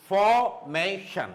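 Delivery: formation slowly split, for, may, shun; syllable by syllable. Shown as a demonstration. Teacher showing three syllables of formation, syllable by syllable. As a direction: separate the three beats of formation. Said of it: "three syllables".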